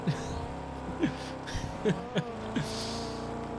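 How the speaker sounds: high, soft chuckling; long quiet giggle at himself; laughing. Real, not acted. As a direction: laugh softly at your own mistake. Laughs at his own story.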